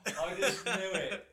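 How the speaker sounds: drawn-out laughing protest; laughing embarrassed. Real, not acted. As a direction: laugh it out, caught red-handed. Caught out, laughing at being teased.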